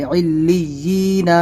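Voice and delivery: علیین stretched slowly, lam doubled, ya sound kept clear; slow recitation. Shown as a demonstration. Teacher showing the ya sound, reciting slowly. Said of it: "تو وہی ی آنا ہے".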